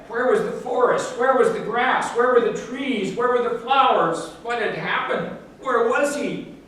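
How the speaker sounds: bewildered questions. A narrator's reading. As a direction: pile up the questions in growing disbelief. Bear confused at factory.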